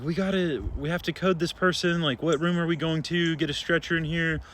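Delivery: urgent code call; urgent orders. Retold or imitated. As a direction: rush the team along. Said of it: "I'm like".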